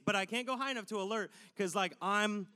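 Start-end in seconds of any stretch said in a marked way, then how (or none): none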